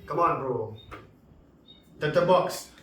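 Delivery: ordinary talk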